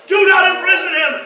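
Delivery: high, loud warning shout; hammed-up urgent shout; retold yell. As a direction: yell a command across the field. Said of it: "this guy over here yells".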